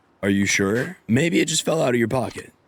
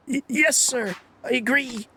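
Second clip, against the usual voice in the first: squeaky, much higher nervous stammer; flustered teacher; 13 semitones higher than usual. Acted. Nervous teacher answering the director, high and flustered.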